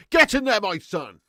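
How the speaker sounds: loud, high cheer of get in there; celebration shout. Retold or imitated. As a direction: bellow it like the goal-celebration clip. Echoing the celebration clip.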